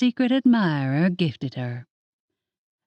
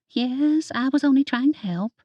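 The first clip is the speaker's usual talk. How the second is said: higher, a small hurt whimper; mild whimper, pitched up; 7 semitones higher than usual. A narrator's reading. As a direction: sound like a scolded child. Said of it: "Amelia stirred her food and whimpered".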